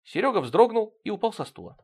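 plain reading voice

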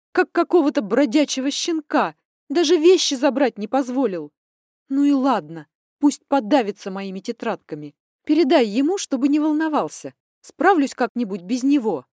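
loud bitter anger; furious outburst. Acted. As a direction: lash out, hurt and loud. Daughter's furious outburst to mother.

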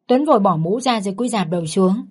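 ordinary delivery, nothing marked

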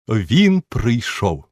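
slow, loud delighted cry; exclaiming duchess. Acted. Duchess greeting the narrator, loud slow exclamation. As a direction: draw it out with delight. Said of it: "вигукнула герцогиня".

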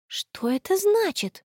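slow, high, puzzled question; bewildered inner thought. A narrator's reading. Friend wonders privately about the photo, bewildered. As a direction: draw it out in high-pitched confusion. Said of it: "растерянно подумала Конни".

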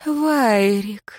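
drawn-out call of an apparition; a lingering, otherworldly summons; ghostly call. A narrator's reading. Apparition calls to the fallen nobleman, ghostly call.

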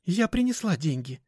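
put-on high-pitched, cheerful girl's announcement; overly happy greeting; very glad. A narrator's reading. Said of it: "как-то очень радостно сказала Даша".

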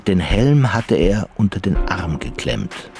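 ordinary talk

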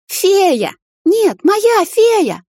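very high drawn-out shriek; shrieking girl. A narrator's reading. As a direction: shriek in panic. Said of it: "взвизгнула девушка". (пронзительный визг)

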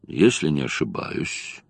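musing softly to himself; low, quiet, pensive murmur; thoughtful. A narrator's reading. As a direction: think it over under your breath. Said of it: "задумчиво сказал он".

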